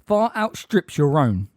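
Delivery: loud, measured indignation; offended retort. A narrator's reading. Magorian answering Umbridge's insult, offended and forceful. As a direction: put her firmly in her place.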